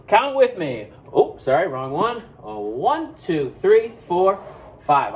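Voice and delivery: ordinary talk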